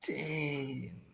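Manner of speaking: whispered curse under breath; whispering. Real, not acted. Muttered aside after a slip.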